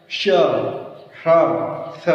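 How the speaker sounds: "श, ह्र, स" each isolated as palatal sh, retroflex sh, dental s; three sibilants contrasted. Shown as a demonstration. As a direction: say श, ह्र, स distinctly with pauses between. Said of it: "फिर से देखिए एक बार".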